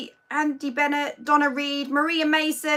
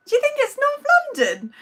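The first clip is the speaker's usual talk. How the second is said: mock disbelief; 12 semitones higher, faster than usual. Teasing a viewer about her accent.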